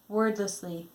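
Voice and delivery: usual speaking voice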